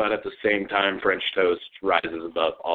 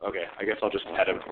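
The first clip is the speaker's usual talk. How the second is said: ordinary delivery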